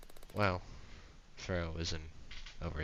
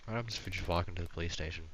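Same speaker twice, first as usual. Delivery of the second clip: rushed; faster than usual. Real, not acted. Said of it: "retry, rushed".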